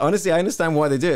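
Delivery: fast, high and eager; excited. Retold or imitated. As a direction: rush it out, thrilled. Excited retold remark.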